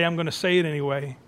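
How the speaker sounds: ordinary talk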